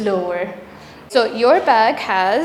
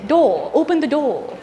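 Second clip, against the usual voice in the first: higher British "door" with no final r; British accent; 3 semitones higher than usual. Shown as a demonstration. Teacher imitating somebody from Britain, British accent. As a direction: imitate a Briton, dropping the r in "door". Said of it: "somebody from Britain would say".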